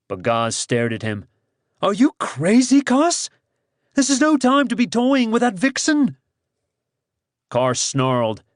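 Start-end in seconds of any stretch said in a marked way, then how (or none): 1.8-6.2 high, urgent, incredulous protest; a startled companion questioning his sanity; alarmed scolding. Acted. Bagaz rebuking Kars for toying with a captive, high and alarmed.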